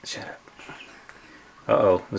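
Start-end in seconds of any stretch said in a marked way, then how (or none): 0.0-0.4 whispered shut up. Real, not acted. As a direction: hiss it softly. Whispered reply to teasing.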